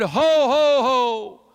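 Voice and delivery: a lightly voiced Santa ho ho ho; booming Santa chuckle, only a hint; Santa laugh. Santa greets the kindergarten with a light jolly laugh. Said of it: "and he said, 'Ho".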